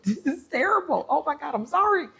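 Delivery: high-pitched, giggly apology; laughing apology. Real, not acted. Apologizing to listeners for the terrible dream, laughing. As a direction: say it through laughter, pitch shooting up. Breaks up laughing mid-story.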